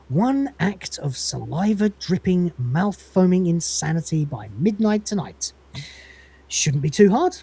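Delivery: measured, drawn-out pondering; slow dramatic musing; slow, deliberate scheming. Acted. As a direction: savour each item of the plan slowly. Schemer weighs up the plan.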